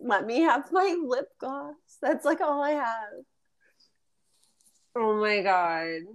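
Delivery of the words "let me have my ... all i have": put-on whiny kid. Mock pleading twelve-year-old.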